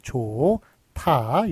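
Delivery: 좋다 very slowly as "조-타"; syllable-by-syllable dictation. Shown as a demonstration. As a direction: sound out 좋다 slowly, "jo... ta". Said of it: "요런 식으로 적어주셔야".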